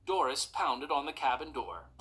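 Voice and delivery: ordinary delivery, nothing marked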